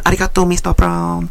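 high put-on Japanese accent; hammed-up Japanese salon worker; mock Japanese voice. Mimicking a Japanese nail technician, mock Japanese accent.

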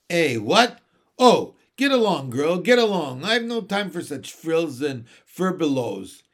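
lightly voiced bird, loud and dismissive; brusque starling. A narrator's reading. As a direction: shoo her away loudly. Starling brushes her off.

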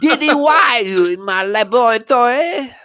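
high, loud cartoon boy-genius voice; Dexter impression. Cartoon genius scolding sister in his lab, high loud voice. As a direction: squeal at your sister for barging in. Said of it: "supposed to be Dexter from Dexter's Lab".

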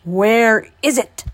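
loud, slow demand. A narrator's reading. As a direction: push out the question, drawn out. Old man demands the pumpkin.

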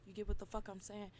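ordinary delivery

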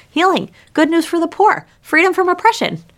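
high, lifted listing of good news; excited listing. Real, not acted. Pastor ticks off the crowd's hopes, high and eager. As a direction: build it up with delight.

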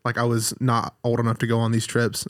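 normal talking voice

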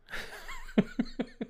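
squealing high laugh; high-pitched laugh. Real, not acted. Laughing at banana joke.

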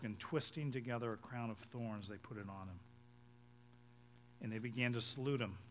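ordinary delivery

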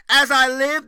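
As I live, loud and high with weight on each word; emphatic. Acted. Preacher booming God's oath at the false shepherds. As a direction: hit As I live hard as a solemn oath. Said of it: "this is emphatic".

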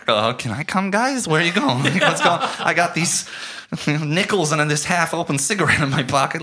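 high, loud, needy tagalong whine; begging Tom. Retold or imitated. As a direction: beg to come along like a stray. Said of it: "Every single time we hang out".

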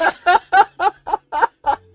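high, loud burst of laughter; real laughter. Real, not acted. Laughing at owl peck.

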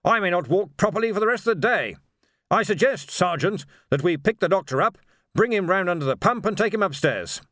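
a pompous archchancellor taking charge; blustering wizard. Acted. Ridcully voiced, blustering wizard.

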